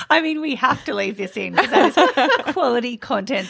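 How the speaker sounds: high, giggling amusement; laughing. Real, not acted. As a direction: chuckle through the words. Cohost laughing at fly mishap.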